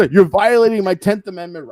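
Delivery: loud, high mock outrage; a goofy protest, shouted; mock legal protest. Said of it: "You're supposed to say something like".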